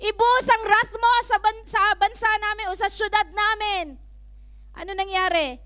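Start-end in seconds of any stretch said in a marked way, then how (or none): 0.1-4.0 loud, high plea for mercy; acted desperate outcry, raised and urgent; crying out to God. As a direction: beg God at full voice, as a whole city would.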